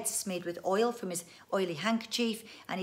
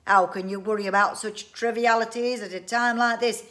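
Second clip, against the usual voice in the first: loud, raised ranting; exasperated outburst; 3 semitones higher, 11 dB louder than usual. A narrator's reading. Inventor scolds family over trivialities, loud and exasperated. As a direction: rant grandly at their small-mindedness. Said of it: "cried Mister Winsborough".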